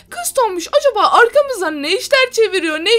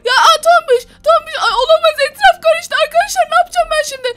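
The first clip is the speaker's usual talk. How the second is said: higher, louder, quick flustered cries; hammed-up panic; 6 semitones higher, 4 dB louder than usual. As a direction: panic as everything falls apart. Panic as fight breaks out.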